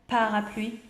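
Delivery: ordinary talk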